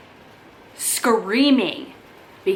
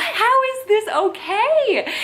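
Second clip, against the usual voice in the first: much higher, slow, stunned; outraged disbelief; 12 semitones higher, 4 dB louder, much slower than usual. Retold or imitated. New hire hearing eviction threats, slow high outrage. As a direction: stretch it out in horrified disbelief.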